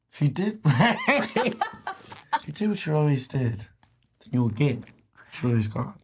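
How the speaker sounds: high-pitched mimicry of a British woman's accent; attempted British accent. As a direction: badly imitate her British accent. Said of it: "I can't do her accent".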